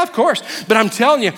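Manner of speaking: ordinary delivery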